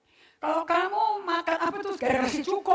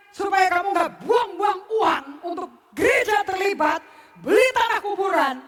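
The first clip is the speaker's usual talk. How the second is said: higher, louder, slow sing-song sermon lines; rhythmic, melodic preaching; 6 semitones higher, 8 dB louder than usual. Shown as a demonstration. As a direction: preach it with rhythm and melody, each phrase landing like a beat. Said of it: "berirama dalam khotbah, bermelodi dalam khotbah". (khotbah berirama dan bermelodi, lebih tinggi dan keras)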